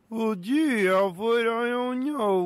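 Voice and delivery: high goofy voice. Acted. Sidekick plays dumb.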